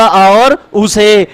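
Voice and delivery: very slow, each vowel stretched out; slow deliberate teaching; slow spelled-out answer. Shown as a demonstration. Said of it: "ओ का निर्माण कैसे हुआ?".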